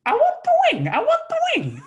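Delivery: put-on very high, loud childish whine; whiny demanding voice. Drunk friend grabbing at the turkey wing, imitated in a high whine. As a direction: beg for the wing like a greedy child.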